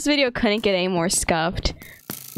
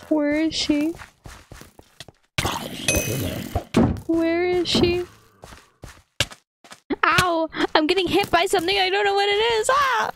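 panicked high calls; 9 semitones higher than usual. Real, not acted. Lost teammate, getting hit.

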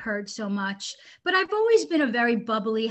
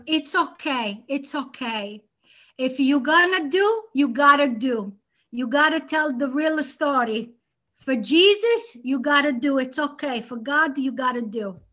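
put-on punchy, louder Italian-accented mother; mother's Italian accent; 2 semitones higher, 4 dB louder than usual. Daughter imitates mom approving for Jesus, Italian accent. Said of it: "like, this Italian accent".

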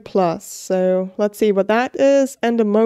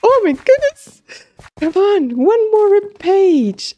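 excited squeal; 8 semitones higher than usual. Real, not acted. Gamer begging the game for one more page, excited squeal. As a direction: squeal with delight, then plead for one more. Gamer thrilled near quest completion.

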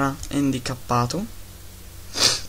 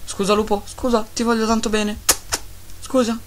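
put-on higher, babying apology to the wolf; pet apology; 8 semitones higher than usual. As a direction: soothe the hurt pet.

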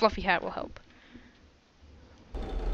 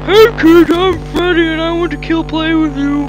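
menacing Freddy voice; 6 semitones higher, 7 dB louder than usual. Pretend. Playing Freddy character.

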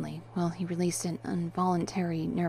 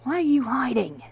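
loud, raised, sharp demand; insistent interrogation, pitched up; 6 semitones higher, 6 dB louder than usual. A narrator's reading. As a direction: demand an answer forcefully. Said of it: "Victor demanded".

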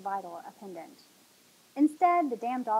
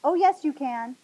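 loud insistence; 4 semitones higher, 11 dB louder than usual. A narrator's reading. Captor insisting over her protest.

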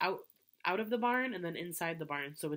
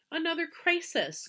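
groaning; 11 semitones higher, 5 dB louder than usual. A narrator's reading. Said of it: "groaned Fern".

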